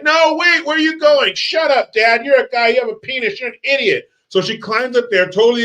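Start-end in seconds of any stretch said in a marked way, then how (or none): 0.0-4.1 panicky dad. Retold or imitated. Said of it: "And the dad's like".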